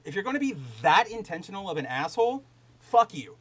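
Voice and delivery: ordinary talk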